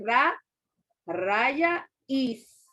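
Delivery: RAÍZ split as ra, raya, is; spaced-out RA... raya... IS; divided into syllables. Shown as a demonstration. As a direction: say RA, the word RAYA, then IS, with pauses. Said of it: "dividir esa palabra en sílabas".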